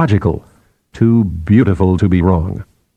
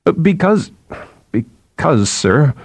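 hesitant, stammered start, high then dropping; flustered and halting; nervous faltering; 7 semitones higher, slower than usual. Acted. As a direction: stall anxiously before a stern boss. Scolded subordinate falters for an answer.

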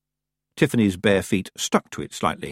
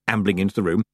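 ordinary delivery

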